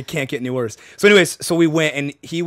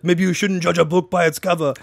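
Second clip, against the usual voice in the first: higher, hammed-up fake Japanese accent; mock Japanese accent; 4 semitones higher, 3 dB louder than usual. Comic re-enacting his buddy's bit, mock Japanese accent. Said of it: "started speaking in, like, you know, Japanese".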